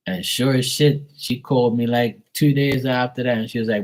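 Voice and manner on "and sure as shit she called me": normal talking voice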